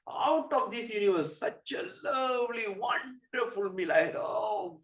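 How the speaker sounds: high, slow, savoured rapture; excited gushing. Acted. Narada boasting of his meal to Shiva, gushing. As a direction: gush dreamily over every word. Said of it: "after hearing all this excitement".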